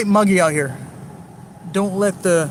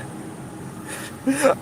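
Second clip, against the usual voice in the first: breathy laugh; 7 semitones higher, 6 dB quieter than usual. Real, not acted. Laugh after awkward shout.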